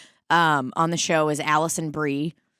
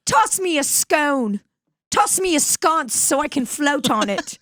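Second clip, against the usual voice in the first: put-on scared Mid-Atlantic accent; 7 semitones higher than usual. Castaway asking Roger for help, mock Mid-Atlantic fright. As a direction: beg in theatrical old-film panic. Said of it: "When she gets really scared".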